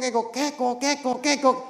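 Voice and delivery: kekok kekok like a bike horn; mock horn honks; imitated horn honk. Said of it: "saya bunyikan kekok".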